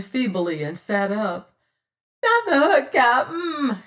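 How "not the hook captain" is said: high, loud terrified wail. A narrator's reading. Spraggins wails at the captain, just awake from his swoon. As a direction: wail it in dread. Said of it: "he wailed".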